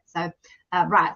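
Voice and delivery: usual speaking voice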